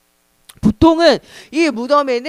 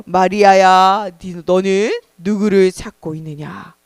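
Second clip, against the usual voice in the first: low, slow voice of Jesus; drawn-out, deep tender call; gentle divine voice; 6 semitones lower, slower than usual. Acted. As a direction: call her slowly, pitched low and solemn. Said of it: "부드러운 소리가 들렸어요".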